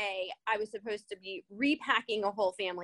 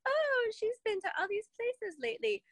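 high, gushing mock onlooker; mock envious outsider; 11 semitones higher than usual. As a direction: lightly mimic people admiring her trips. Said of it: "It's like".

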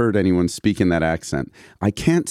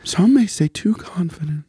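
much higher, doubting voice; questioning yourself voice; 10 semitones higher than usual. Acted. Joking about being too confident, high and unsure. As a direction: say it like you're second-guessing yourself. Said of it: "questioning yourself voice".

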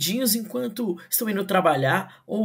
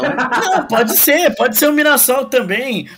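laughing banter; 7 semitones higher, 9 dB louder than usual. Real, not acted.

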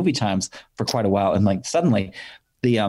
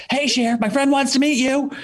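calling out. Retold or imitated. Friend calling Cher over in the produce aisle, high call. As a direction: shout up to her brightly to get her attention.

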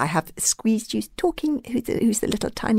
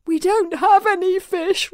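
high, slow, strained restraint; exasperated, losing calm, word by word; through gritted teeth; 13 semitones higher than usual. A narrator's reading. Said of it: "repeated Hiccup from between gritted teeth".

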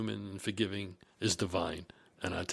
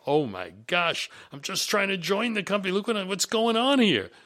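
climbing, raised-pitch bewildered protest; retold dismay; 9 semitones higher, 4 dB louder than usual. New recruit to himself after being yelled at, dismayed. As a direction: act out growing disbelief. Acting his dismayed inner reaction.

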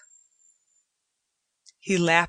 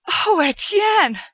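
much higher and louder, slow delighted cry; ringing delight; 13 semitones higher, 8 dB louder, slower than usual. A narrator's reading. Joanna sees the cat enter and cries out in delight. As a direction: cry out with pure joy. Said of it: "in a voice ringing with delight".

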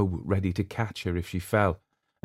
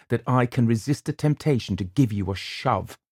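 furious; 5 semitones higher than usual. A narrator's reading. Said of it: "he added furiously".

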